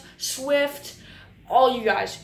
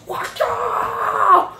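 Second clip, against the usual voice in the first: sharp war cry, much higher and louder; battle yell; 11 semitones higher, 7 dB louder than usual. Retold or imitated. Servant stabbing Michael, high battle yell. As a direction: yell it as the sword swings. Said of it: "and he goes".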